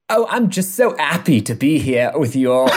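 put-on bubbly, overdone English accent, giggling; a gushing English girl, badly faked. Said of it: "I can't do this accent for very long".

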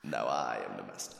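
low, soft dark-lord voice; menacing Sith master; deep villain voice. Pretend. Star Wars master role play.

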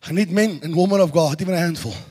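soft, low intimate declaration; hushed, solemn voice of God. Acted. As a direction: speak it close and quiet, as God.

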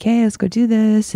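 sing-song rallying call; upbeat cheer. Retold or imitated. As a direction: pump everyone up like the happy one. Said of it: "and I'm like".

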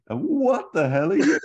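high, loud outraged squawk; incredulous yell. Retold or imitated. Ferrell gaping at Ludo, high incredulous yell. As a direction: yell it at the big furry thing. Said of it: "And Ferrell says".

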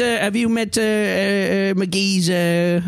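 high-pitched NPC voice; a high squeaky voice for the NPC; high character voice. Acted. NPC introduces creatures.

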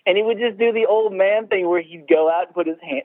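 usual speaking voice

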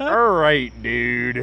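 loud, high-pitched brush-off; backing away from a weird cult guy; sceptical brush-off. Retold or imitated. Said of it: "I'm like".

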